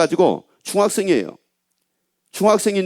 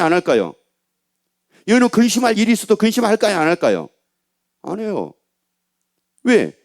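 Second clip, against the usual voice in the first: ordinary delivery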